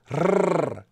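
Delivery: long trilled R, tongue tip buzzing on the palate; Spanish rolled R demo. Shown as a demonstration. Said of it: "you roll the R".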